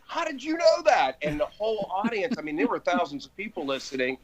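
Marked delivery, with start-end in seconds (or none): 0.1-1.2 amazed caller. Retold or imitated. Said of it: "the guy would go".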